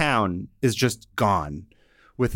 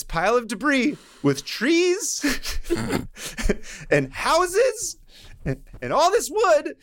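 excited build-up; 10 semitones higher than usual. Real, not acted. Building to punchline, laughing.

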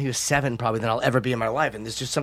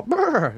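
imitated horse noise; 12 semitones higher than usual. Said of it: "they instinctively go".

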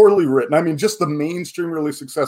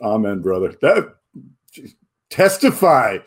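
mock preacher amen; 3 semitones lower than usual. Answering his friend's music sermon with a mock church amen. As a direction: play along like a church congregant.

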